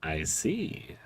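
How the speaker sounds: low, soft eyeball voice; lightly voiced creepy eyeball; talking eyeball. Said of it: "says the eyeball".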